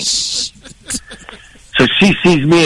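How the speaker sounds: usual speaking voice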